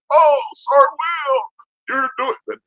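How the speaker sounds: high, slow pleading voice; high mocking whimper from soldiers being thrown around; mock whiny trainees. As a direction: whine it like a beaten trainee begging. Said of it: "they'd be like".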